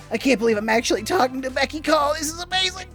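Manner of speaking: high, rushed gushing; overwhelmed fan meeting an idol; starstruck fanboy. Acted. Fanboy meets his idol.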